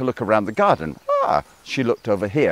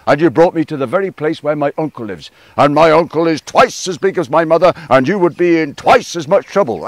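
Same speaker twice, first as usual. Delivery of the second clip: louder, higher building warning; frog's growing threat; 5 semitones higher, 7 dB louder than usual. Acted. Frog scaring crow about her uncle, loud building warning. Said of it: "said Frog".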